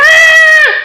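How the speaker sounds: high startled scream at the roach; retold scream. Retelling finding a roach, a sharp shriek. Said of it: "Like that".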